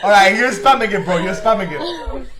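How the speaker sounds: laughing through the words; half laughing, loud and amused. Real, not acted. Laughing at a friend's spam.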